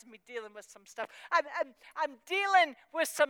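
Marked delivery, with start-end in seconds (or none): none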